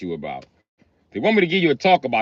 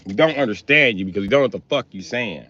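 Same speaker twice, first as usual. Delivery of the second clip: normal talking voice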